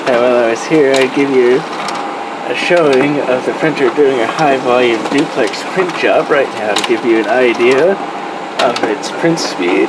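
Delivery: speaking up over a whirring printer. Real, not acted. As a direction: project loudly over machine noise. Talking over running printer noise.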